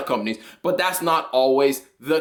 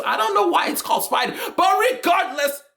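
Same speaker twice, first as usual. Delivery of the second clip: exasperated outburst; 11 semitones higher than usual. Real, not acted. Baffled by the name Spider.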